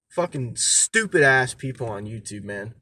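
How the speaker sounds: ordinary talk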